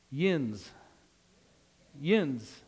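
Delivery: "yins" as one syllable, rhyming with "bins"; western Pennsylvania dialect. Shown as a demonstration. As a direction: show how Pittsburgh-area locals say "yins", falling. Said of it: "If you're from western Pennsylvania".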